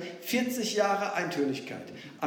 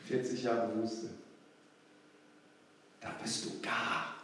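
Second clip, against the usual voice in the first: low, much softer, near whisper; weary hush; 10 semitones lower, 10 dB quieter than usual. Real, not acted. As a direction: let it sink, drained. Dramatische Pause, erschöpft. (leise, erschöpft, fast geflüstert)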